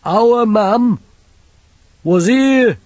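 put-on high, slow, childlike big lad; simple lad voice. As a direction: voice him like a big simple boy. Banjo's voice again.

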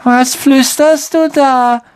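high, slow, drawn-out question; whiny ghost girl. Acted. Myrte to Harry, high and drawn-out. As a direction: stare him down.

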